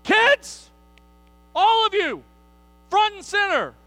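put-on high, loud parent summoning the kids; a parent loudly summoning the kids; parent calling kids. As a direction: call the kids front and center like a stern parent.

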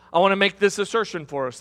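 normal talking voice

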